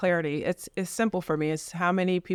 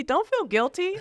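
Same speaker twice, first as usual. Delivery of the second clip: emphatic reassurance; 13 semitones higher than usual. Retold or imitated. Agent reassures client, retold.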